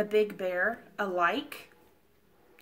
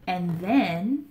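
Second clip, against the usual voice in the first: storybook wonder; 2 semitones higher than usual. Acted. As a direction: gasp at the sight for the children. Reads story to children.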